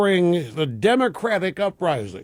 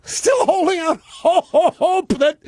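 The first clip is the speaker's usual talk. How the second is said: laughing; 11 semitones higher, 3 dB louder, slower than usual. Real, not acted. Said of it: "and I'm laughing".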